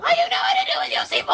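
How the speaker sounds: put-on fast, very high kid screech; screaming five-year-old. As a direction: wail it loud like a child in a tantrum. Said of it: "my impression of a five-year-old screaming at me".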